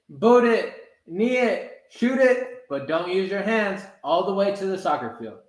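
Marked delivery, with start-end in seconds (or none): none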